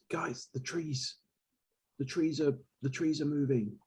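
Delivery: slow, soft, uneasy warning; hushed, slow warning. Acted. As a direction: quietly warn the group. Telepathic warning about moving trees.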